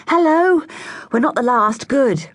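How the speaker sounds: high, loud happy hello; cheerful greeting. A narrator's reading. Girls bursting into the meeting, cheerful high greeting. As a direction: beam as you burst in.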